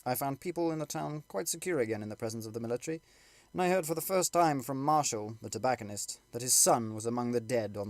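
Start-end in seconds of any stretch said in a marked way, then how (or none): none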